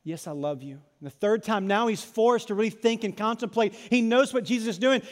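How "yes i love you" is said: lightly voiced hurt confession; broken Peter. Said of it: "looks at him broken and says".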